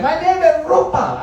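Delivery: high-pitched imitation, rolling the R in Rupa; rolled R. Indian coworker correcting Brad, rolling the R in Rupa. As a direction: trill the R of Rupa indignantly. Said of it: "she rolled the R on me".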